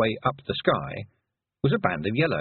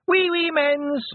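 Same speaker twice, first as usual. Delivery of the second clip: put-on high, loud toddler squeal; toddler yell; 4 dB louder, slower than usual. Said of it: "he yelled".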